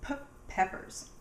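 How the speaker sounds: "p" popped alone, then "peppers"; first sound split off. Shown as a demonstration. Teacher naming sweet peppers for children, first sound split off. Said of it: "They all make the p sound".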